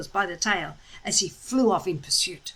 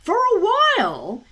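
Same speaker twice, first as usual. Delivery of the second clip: ordinary delivery, nothing marked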